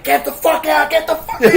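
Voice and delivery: high, loud laughing shout of a gangster's threat; mock yell through laughter. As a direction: bellow it while cracking up at the scene. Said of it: "he's like".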